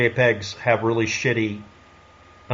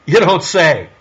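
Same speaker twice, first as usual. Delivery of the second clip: sarcastic mock surprise; snarky sarcasm; 2 semitones higher, 4 dB louder, slower than usual. Shown as a demonstration. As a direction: say it with pointed snark. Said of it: "I'm being snarky and sarcastic".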